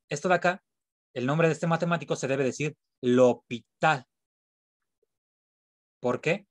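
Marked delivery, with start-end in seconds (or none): 3.0-4.1 l'hôpital with the stress on the last syllable, "tal"; stress on final A. Shown as a demonstration. Teacher demonstrates the mathematician's name, final-A stress in l'hôpital. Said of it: "voy a acentuar la A".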